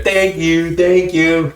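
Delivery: put-on high, dutiful little-kid voice; polite kids. Kids prompted by their parents, politely chiming. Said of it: "these are all polite".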